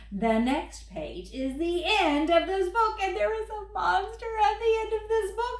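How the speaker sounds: furry monster Grover, fretful and pleading. Acted. Grover reading his book to the kids, worried and pleading. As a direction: play lovable Grover begging the child not to turn pages.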